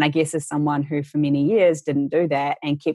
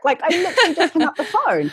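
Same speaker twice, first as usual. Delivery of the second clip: much higher, laughing through the words; laughing disbelief; 13 semitones higher than usual. Real, not acted. Telling the host about the timing, laughing in disbelief. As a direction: chuckle at the absurd timing.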